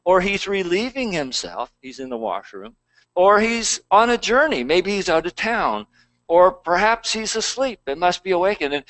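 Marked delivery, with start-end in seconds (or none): 0.0-1.7 higher sarcastic jab; mock their silent god. A narrator's reading. Elijah taunting Baal's prophets, higher sarcastic mockery. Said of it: "Elijah mocked them". 3.2-8.7 much higher teasing sarcasm; mocking. A narrator's reading. Elijah taunting Baal's prophets, high teasing sarcasm. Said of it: "Elijah mocked them".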